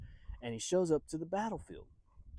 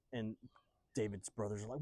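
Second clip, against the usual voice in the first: usual speaking voice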